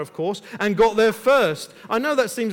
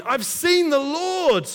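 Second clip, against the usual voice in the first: excited cry; 7 semitones higher, 4 dB louder, slower than usual. Retold or imitated. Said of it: "came bursting in".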